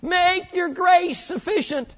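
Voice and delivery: high, slow whining plea; mock pleading prayer. Believer begging God in prayer, high mock plea. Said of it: "For instance, we say".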